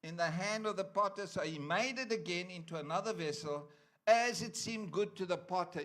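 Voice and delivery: loud, declaiming scripture reading. Acted. Preacher reading Jeremiah aloud to the church. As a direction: proclaim the verses to the congregation.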